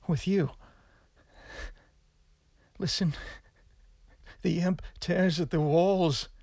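a condemned man raving about the imp; madman voice. Acted. Madman confesses in cell.

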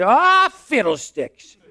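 put-on high, slow folksy old codger; old prospector. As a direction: grumble it like an old gold miner. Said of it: "talk in such a folksy, down-home way".

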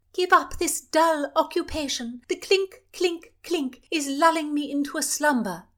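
high, loud and bored royal demand; the princess scolding the doctor, high and loud. Acted. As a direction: cut him off like a spoiled royal. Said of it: "the princess demanded".

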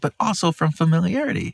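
hammed-up, high-pitched puzzlement; exaggerated wide-eyed question; mock astonishment.

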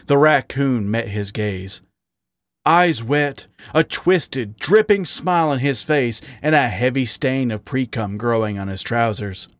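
usual speaking voice

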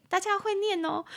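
teasing. Real, not acted. Teasing the learners.

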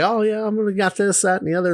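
mock eager. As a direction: gush about your big plans.

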